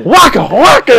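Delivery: high imitated whooshing; mock whip sound. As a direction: make the belt noise. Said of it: "I hear".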